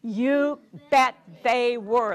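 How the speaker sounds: slow, stressed on bet; emphatic. Acted. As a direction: lean hard on "bet", slowly. Emphatic storytime answer.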